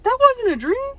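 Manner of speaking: high, wide-eyed realization; dazed Laurent. Retold or imitated. Mocking Laurent's dawning shock, high-pitched. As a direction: gasp it out in awe. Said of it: "Laurent's just kinda like".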